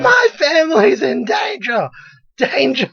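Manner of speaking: high-pitched panicked shouting; mock hysterical scream. Imitating the beach woman with tape measure, hysterical shriek. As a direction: shriek it like a hammed-up Karen. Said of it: "all you see is her screaming".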